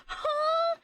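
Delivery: very high squeaked huh; confused huh. Retold or imitated. Her own confused echo.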